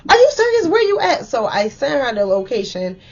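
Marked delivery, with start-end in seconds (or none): 0.1-1.3 alarmed mom. Retold or imitated. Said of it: "She like".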